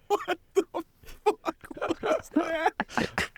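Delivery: very high, strangled disbelief; high-pitched, drawn-out mock bewilderment; shocked co-star impression. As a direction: squeak it out slowly, as if just choked. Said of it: "John Travolta's like".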